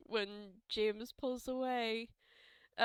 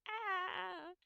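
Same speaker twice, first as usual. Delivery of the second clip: moved high cry; 8 semitones higher, 4 dB quieter than usual. Real, not acted. Player moved by the scene.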